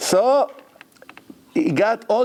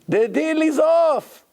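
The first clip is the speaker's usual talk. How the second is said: 1 distressed; 12 semitones higher, 3 dB louder, slower than usual. Retold or imitated. Broker announces deal collapse.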